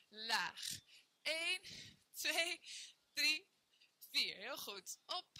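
ordinary talk, nothing marked

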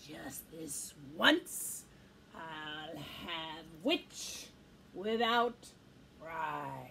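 very low, slow menace; gloating dragon. A narrator's reading. Dragon closes in on the witch, low and slow. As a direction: savour each word in a deep dragon voice. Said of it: "glint in his eyes and said".

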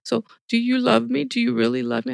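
a hint of a weeping child begging to hear it; tearful child asking. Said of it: "was crying still and said".

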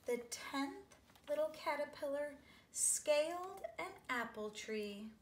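playful high climbing read; rising climb. A narrator's reading. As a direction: lift the voice as it climbs up. Climbing, voice rises.

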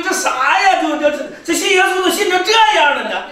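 high, stammering comic disbelief; mock frightened old man. Preacher acting out the scared new believer backing away, high-pitched bewilderment. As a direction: stumble over the words in hammed-up alarm. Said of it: "他害怕就往后退".

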